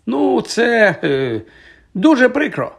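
high-pitched, hesitant sympathy; evasive regret. A narrator's reading. Professor dodging a question about the sister, evasive. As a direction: squirm through an awkward condolence. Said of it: "уникаючи їхніх очей".

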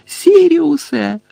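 long, high-pitched loud yell; drawn-out scream of a name; shouting. A narrator's reading. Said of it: "закричав Гаррі".

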